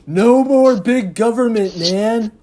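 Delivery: lightly voiced laid-back hippie van; a hint of a mellow hippie drawl; Fillmore voice. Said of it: "Fillmore looks at you".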